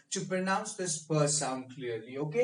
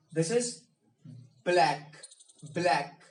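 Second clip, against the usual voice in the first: ordinary delivery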